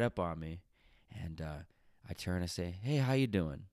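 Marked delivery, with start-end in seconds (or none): 2.8-3.7 higher, louder, pointed hello; passive-aggressive fake friendliness; passive-aggressive greeting. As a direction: greet them as a hint to back off. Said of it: "that was my passive aggressive way of saying".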